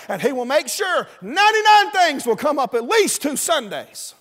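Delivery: high, loud pulpit outburst; full-force preaching. Real, not acted. Preacher warning church of obstacles, full-force preaching. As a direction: ring it out like a preacher.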